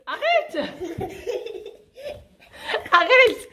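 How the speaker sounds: laughing while being tickled. Real, not acted. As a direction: squeal "stop" through giggles. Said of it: "on était en pleine séance chatouillage".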